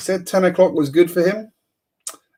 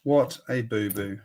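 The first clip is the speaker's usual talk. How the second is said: What a boo-boo muttered softer; quiet repeat; 4 semitones lower, 7 dB quieter than usual. Real, not acted. Repeating the sellout blunder to himself, softer. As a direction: drop it to a soft afterthought.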